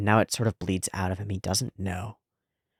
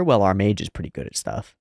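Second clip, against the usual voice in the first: ordinary talk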